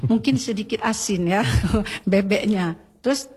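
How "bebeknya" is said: ordinary delivery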